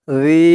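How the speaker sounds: জি with a thin, soft "dh" sound and long vowel; gentle lisped dhal in জি. Shown as a demonstration. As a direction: say জি lightly as a soft dhal, held. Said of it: "একদম পাতলা করতে হবে, নরম করতে হবে".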